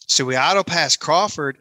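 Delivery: usual speaking voice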